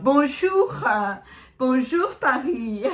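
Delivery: slow, haughty drawl; stuck-up snob voice. Pretend. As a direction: greet Paris like a snob. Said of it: "Je vais prendre un air de pute coincée".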